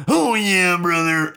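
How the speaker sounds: loud, high raspy wrestler growl; hammed-up wrestling promo yell; wrestler impression. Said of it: "Just give it an honest try".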